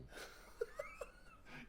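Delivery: high breathless laugh. Real, not acted. Laughing at the kid's question about walking normally, high giggle. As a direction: let a giggle escape.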